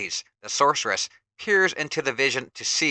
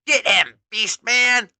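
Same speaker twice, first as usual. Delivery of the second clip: higher, louder command; shouted order; 9 semitones higher, 8 dB louder, slower than usual. Acted. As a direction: sic the henchman on him. Villain orders henchman.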